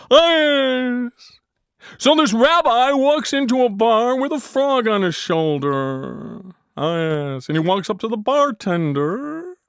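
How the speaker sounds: high nasal W C Fields drawl. Acted. W C Fields stumbling in to tell a joke, high drawl. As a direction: ham up an old-time comic drawl. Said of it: "W C Fields".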